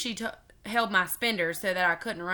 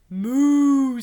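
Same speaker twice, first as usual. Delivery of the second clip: loud, higher, drawn-out moo; 6 semitones higher, 10 dB louder than usual. Acted. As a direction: stretch it out like a little one's moo. Ollie moos at passing witch.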